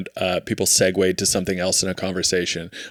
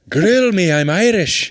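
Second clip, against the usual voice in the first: mock Irish; 9 semitones higher, 3 dB louder, slower than usual. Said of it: "He goes".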